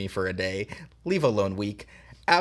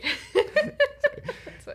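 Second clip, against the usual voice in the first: high giggle. Real, not acted. Giggling fit mid reading.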